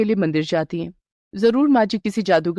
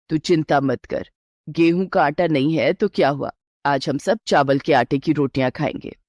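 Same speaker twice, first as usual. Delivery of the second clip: a lightly lowered, steady mother-in-law; 5 semitones lower than usual. Acted. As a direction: reassure the bride as a knowing elder. Mother-in-law calms the bride. (हल्की सी नीची आवाज़ में सास)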